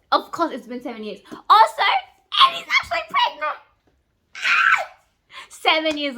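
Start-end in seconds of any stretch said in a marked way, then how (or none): 1.5-3.6 high, squealing burst of news; excited squeal. Real, not acted. Reader shares the pregnancy twist with viewers, squealed excitement. 4.3-4.8 short, very high, loud squeal; excited scream. Real, not acted. Reader reacts to Eddie's pregnancy, delighted scream.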